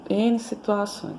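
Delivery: usual speaking voice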